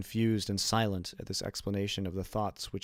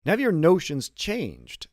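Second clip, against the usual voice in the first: asked in raised, louder astonishment; surprised; 7 semitones higher, 7 dB louder than usual. A narrator's reading. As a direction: caught off guard, voice jumping up. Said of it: "with much surprise".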